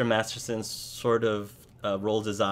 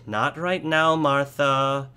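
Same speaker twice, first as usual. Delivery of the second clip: somewhat raised, louder brush-off; lightly voiced husband; 5 semitones higher, 5 dB louder, slower than usual. Busy farmer putting off his worried wife, mildly impatient. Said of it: "Dagon says".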